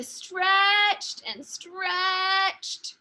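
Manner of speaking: very slow, stretched words; drawn-out stretch. A narrator's reading. Narrator telling Sophia straining at the wall, very slow and drawn out. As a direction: stretch the words like the reach itself.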